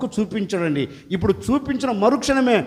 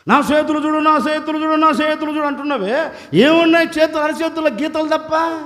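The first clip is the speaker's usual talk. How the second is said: higher, scoffing retort; mocking woman; 6 semitones higher than usual. The woman mocks Jesus's request, higher and scornful. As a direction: scoff at his words. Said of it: "ఆ అమ్మ కూడా అదే అన్నది".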